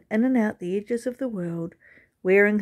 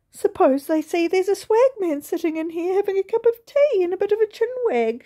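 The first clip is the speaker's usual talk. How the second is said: much higher, quick anxious voice; fretting widow; 11 semitones higher, 5 dB louder than usual. A narrator's reading. Mrs Gimble fretting about the neighbours, high and hurried. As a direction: rush it out, worried what neighbours see. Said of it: "she thought".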